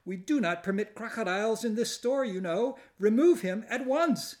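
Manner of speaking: high, loud, stiff outrage; indignant store manager. Acted. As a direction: order her out, scandalised. Angry store owner scolding customer.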